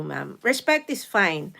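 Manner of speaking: usual speaking voice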